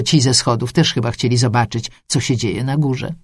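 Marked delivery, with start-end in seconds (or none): none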